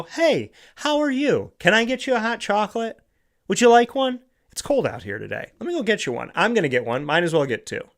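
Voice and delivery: high, cheery voice for a stranger on a rough day; bright friendly offer. Retold or imitated. Talking to a stranger at the Christmas tree farm, high cheery offer. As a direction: lift the pitch way up, kind and eager. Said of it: "I go".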